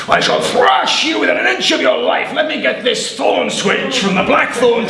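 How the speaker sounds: mild mock fury; devil's threat. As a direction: bluster like a beaten bully.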